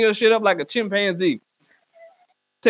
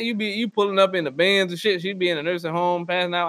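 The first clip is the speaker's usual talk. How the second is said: normal talking voice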